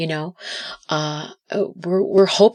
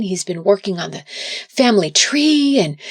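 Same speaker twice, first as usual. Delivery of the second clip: ordinary talk, nothing marked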